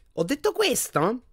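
very high, slow questioning; lofty feigned innocence. A narrator's reading. Bored portrait deflecting Harry's question, loftily innocent. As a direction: raise the pitch in mock surprise.

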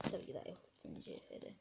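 soft unvoiced confession; whispered aside. Player admits to viewers it is gold, whispering. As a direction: drop to a secret whisper.

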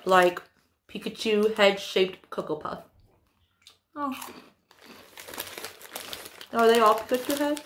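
chewing while talking; eating snack between words; mouth full. Real, not acted. Seen 0: bites cereal piece at 1.7, chews through 5.0, holds another.

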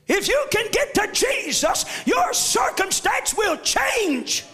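shouted gospel preaching with rising fervor; full-force preaching. Acted. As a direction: lift the church with each line.

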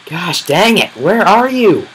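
high, loud frantic yell; gosh-dang-it panic shout; startled shout. Real, not acted. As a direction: yelp it out in alarm. Startled by a mob attack.

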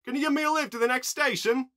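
high, loud request; loud plea. A narrator's reading. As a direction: call up to the crew for help. Stranded surfer asks for help.

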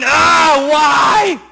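crying out in pain. Acted. Leper crying out as dirt gets in his wounds, anguished. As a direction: act out a pained protest to heaven. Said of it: "he's crying out of pain".

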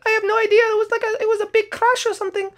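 very high sleepy little character voice; Sing Song voice. Acted. Sing Song agreeing to go to bed, very high squeaky voice. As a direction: squeak it like a tiny sleepy camper.

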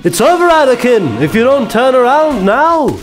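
mock Obi-Wan nagging Anakin in battle; urgent Obi-Wan. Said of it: "I'm Obi-Wan".

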